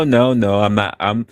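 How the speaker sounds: usual speaking voice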